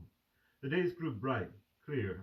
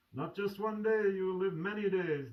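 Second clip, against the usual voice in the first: put-on the old doctor answers a bit higher and louder; doctor's reassurance; 9 semitones higher, 3 dB louder than usual. The old doctor answers the joking patient, reassuringly. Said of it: "the doctor would answer".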